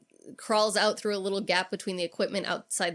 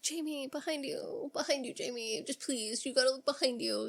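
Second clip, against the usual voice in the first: put-on soft, higher-pitched drunken plea; drunk pleading; 4 semitones higher, 7 dB quieter than usual. Drunk Randy pleading with Jamie on screen, soft and higher. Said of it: "He's so fucking drunk".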